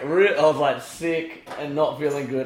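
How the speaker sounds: ordinary delivery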